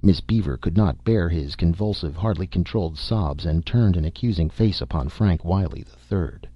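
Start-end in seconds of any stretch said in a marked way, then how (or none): none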